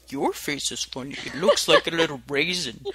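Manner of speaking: deadpan the game line low; low quoted game voice. Retold or imitated. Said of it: "going like".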